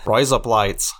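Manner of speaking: razor blades in mock Aussie; put-on Australian vowels; Australian accent. Shown as a demonstration. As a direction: demonstrate the Australian-accent trick phrase. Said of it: "If you wanna say razor blades".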